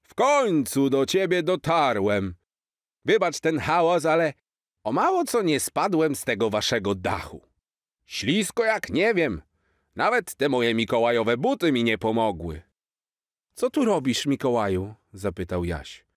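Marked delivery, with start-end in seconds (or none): 0.0-12.6 lightly voiced jolly Santa, higher; a cheerful old Santa, slightly put-on; hint of Santa. Santa to Jaś on the roof, lightly voiced jolly. 13.5-14.9 put-on little boy. Boy asks Santa.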